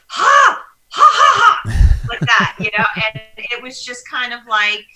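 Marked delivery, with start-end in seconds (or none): 0.1-2.0 booming shouted belly laugh; loud laugh. Retold or imitated. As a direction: bark out big laughs like dad at the TV. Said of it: "like, laughing".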